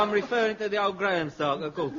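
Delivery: ordinary talk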